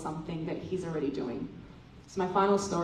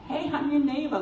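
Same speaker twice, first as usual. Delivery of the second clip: mock cheerful greeting; 7 semitones higher, 3 dB louder than usual. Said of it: "just go knock on someone's door and be like".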